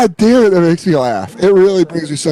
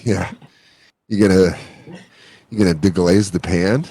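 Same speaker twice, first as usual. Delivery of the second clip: seedy, horny caller; 8 semitones lower, 3 dB quieter than usual. Retold or imitated. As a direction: imitate a seedy phone-line creep, pitched down. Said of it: "such a seedy, horny voice for food".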